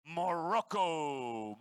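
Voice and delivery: stretched slow, ringing out the name; long boxing-MC drawl on Morocco; drawn-out announcer call. Acted. Ring announcer calling out country.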